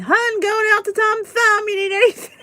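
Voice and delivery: high, loud singsong calling out to husband; mock spouse call. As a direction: call to hubby, fighting a laugh. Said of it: "I can't say it without laughing".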